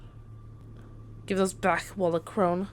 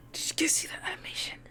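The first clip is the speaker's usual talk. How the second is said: hushed excited whisper to viewers; 4 dB quieter than usual. Real, not acted. Gamer whispers to viewers about the animation, hushed. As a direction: breathe it out in awe.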